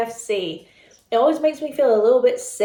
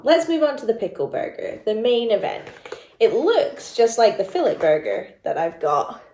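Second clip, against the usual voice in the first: ordinary talk, nothing marked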